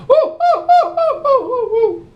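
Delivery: very high, loud frantic hooting with laughs; desperate monkey hoots. Acted. Man in gorilla suit facing a waking lion, desperate hoots. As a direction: hoot like your life depends on it. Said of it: "And he's like".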